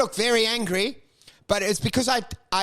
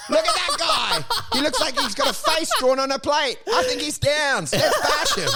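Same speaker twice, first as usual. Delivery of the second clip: giggling, higher, egging the crowd on; laughing mock bully; 12 semitones higher than usual. Deflective bully laughing as he turns the crowd on a boy. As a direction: cackle while pointing out the kid.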